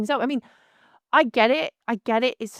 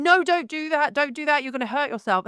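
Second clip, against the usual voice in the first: urgent call to stop; 6 semitones higher than usual. Retold or imitated. Stopping child pulling mirror.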